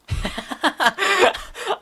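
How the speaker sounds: high squealing laugh; real shriek of laughter. Real, not acted. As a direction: burst out laughing in disbelief. Big G is a child.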